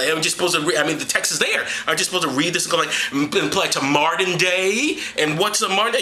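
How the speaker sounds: ordinary talk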